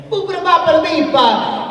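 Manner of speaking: high, loud cartoon Italian gibberish; bad Italian accent. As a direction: ham up a Family Guy Italian accent. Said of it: "Peter does a bad Italian accent".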